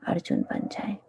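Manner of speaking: soft, low meditation guidance; guided meditation. Real, not acted. Teacher seating disciples for meditation on God's name, soft guided meditation. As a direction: settle them into meditation with long pauses.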